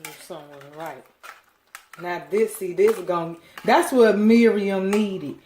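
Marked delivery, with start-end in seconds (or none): 2.0-5.4 much louder and slow, triumphant; excited declaration. Real, not acted. Cook telling viewers what Miriam's dish lacked, triumphant. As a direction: announce it like the answer to everything. Excited about the missing ingredient.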